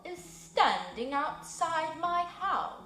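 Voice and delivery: slow, booming owl calling from his hollow; a resonant old owl, unhurried and wary; an owl challenging strangers outside his tree, slow and resonant; organ-toned owl voice. Acted. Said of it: "a deep round voice, a voice like an organ tone".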